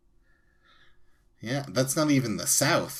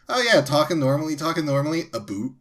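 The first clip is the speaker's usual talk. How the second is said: quick, high Canadian chatter dropping to "a boot"; subtly Canadian accent; 7 semitones higher, 7 dB louder, much faster than usual. Retold or imitated. Joking about subtle accents, mock Canadian accent. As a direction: rattle along loudly, then land the Canadian vowel. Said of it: "they're, they're subtly Canadian".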